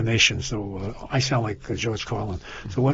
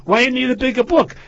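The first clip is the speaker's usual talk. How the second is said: high, loud acted query; incredulous question; 10 semitones higher, 6 dB louder than usual. Psychiatrist questioning the father, loud and high for the joke. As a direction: raise pitch and volume for the joke's setup. Acted doctor in joke's punchline.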